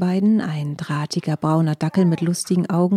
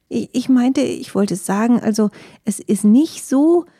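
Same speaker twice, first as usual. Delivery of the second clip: higher, louder frightened stammer; startled stutter; 5 semitones higher, 4 dB louder than usual. A narrator's reading. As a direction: trip over your words in alarm. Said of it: "stotterte die Füchsin nun erschrocken". (erschrocken stotternd, höher und lauter)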